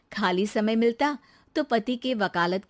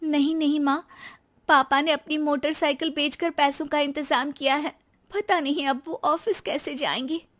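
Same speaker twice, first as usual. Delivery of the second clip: put-on higher, pleading young wife's voice; pleading daughter-in-law; 6 semitones higher than usual. Daughter-in-law explaining father's sacrifice, higher and pleading. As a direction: beg her to understand, pitched up.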